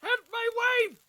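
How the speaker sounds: high anguished shout; desperate cry. Acted. Husband crying out for his sick wife, anguished shout. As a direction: scream for help for his wife.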